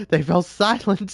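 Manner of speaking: maniac voice. Acted. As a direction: relish the silence madly. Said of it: "maniac voice".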